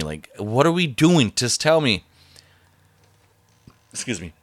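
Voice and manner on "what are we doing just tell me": higher, pushy nagging voice. Retold or imitated. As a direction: imitate her nagging, pressing for an answer. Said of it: "and just nagging me".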